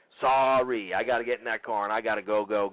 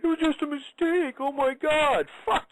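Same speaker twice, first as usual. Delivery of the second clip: whiny falsetto, far higher, mocking tears; hammed-up high wail mocking fake regret; mock crying; slower than usual. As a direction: squeal it like a fake-sorry crybaby. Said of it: "crying and going".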